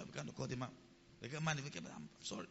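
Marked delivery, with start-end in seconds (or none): 1.9-2.5 very low, hushed retold apology; meek apology. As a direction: apologise softly, almost under the breath. Late doctor apologising, retold.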